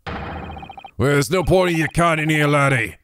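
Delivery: scornful Irish brogue; dismissive Irish governor. Acted. Governor sends the lawyer away, in a scornful Irish accent. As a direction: brush him off in an Irish accent.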